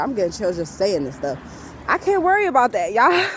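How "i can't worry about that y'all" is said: higher, louder insistence; preaching to herself, higher and louder; emphatic raised voice. Real, not acted. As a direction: say it like you mean it, rising. Repeats phrase, louder and higher.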